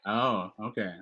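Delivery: ordinary talk, nothing marked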